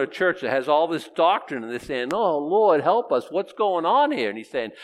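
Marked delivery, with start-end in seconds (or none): 2.1-4.3 pleading cry to God. Acted. Believers voiced crying to God.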